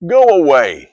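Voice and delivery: loud, sharp dismissal; firm command. Acted. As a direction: order the crowd out. Jesus sends out mourners.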